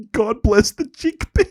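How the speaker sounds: high, giggly delivery, drawn out slowly; tickled, stretched-out punchline; amused Clooney quote. Retold or imitated. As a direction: quote it slowly through rising laughter. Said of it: "The way he says it".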